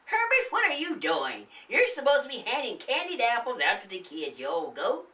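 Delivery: high, nagging wife's voice; scolding old woman. Acted. The old woman scolding her husband about the candy, high nagging. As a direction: tell off your lazy husband.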